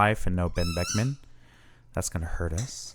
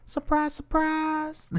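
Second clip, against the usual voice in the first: very high, drawn-out silly voice; slow, piping mock surprise; playful put-on voice; slower than usual. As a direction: stretch it out in a jokey high pitch. Repeats the silly voice, laughs after.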